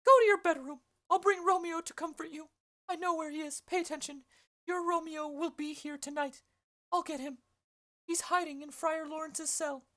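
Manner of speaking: high-pitched nurse voice, loud at first then settling; the Nurse promising to fetch Romeo, high. Acted. Nurse's lines in read play.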